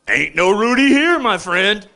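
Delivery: armed stranger. Retold or imitated. Armed man answering.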